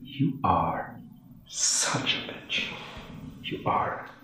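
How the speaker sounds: low, soft bitter grumbling; low bitter mutter to wife. Acted. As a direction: grumble it under breath in the dark. Husband mutters after lights out.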